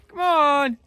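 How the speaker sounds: high, loud call across a field. Real, not acted. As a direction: holler the goats in. Calling goats from field.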